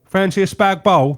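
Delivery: slow, punchy local twang; a mate back home ordering dinner; local accent. Retold or imitated. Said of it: "people from my neck of the woods call it".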